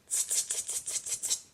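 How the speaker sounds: soft unvoiced teasing tsk tsk; cheeky monkeys clicking back at him. A narrator's reading. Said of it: "the monkeys only looked at him and said".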